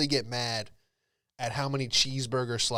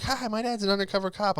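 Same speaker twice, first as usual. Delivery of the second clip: put-on higher eager kid voice; kid bragging; 7 semitones higher than usual. Fourth grader tells classmates about his dad, eager kid voice. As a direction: chirp it like a proud fourth grader. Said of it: "And he's like".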